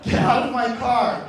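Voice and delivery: loud, high, comic outrage; indignant order. Acted. Driver ordering a stranger out of the car, indignant.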